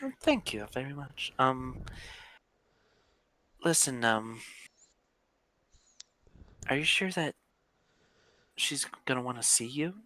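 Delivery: put-on young woman voice. GM voices Aurora NPC.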